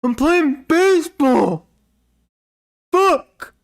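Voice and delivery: high shrill-pitched furious yell at the dog; angry kid yelling. Retold or imitated. Gamer kid screaming at his barking dog, furious high yell. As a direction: scream it like a bratty kid losing it. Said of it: "And he's like".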